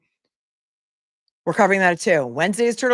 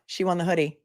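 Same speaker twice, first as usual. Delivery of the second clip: ordinary talk, nothing marked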